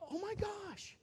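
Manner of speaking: high, soft mock squeal; tiny high-pitched voice of astonishment; amazed. Preacher finds the heater works, hushed high amazement.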